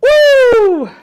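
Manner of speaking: loud high woo; hype cheer. Real, not acted. Streamer cheering after revealing his trip, loud whoop. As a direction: let out a big excited whoop.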